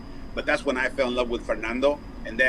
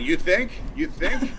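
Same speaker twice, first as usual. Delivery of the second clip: sarcastic retort; 11 semitones higher, 5 dB louder, slower than usual. Retold or imitated. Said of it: "I'm like".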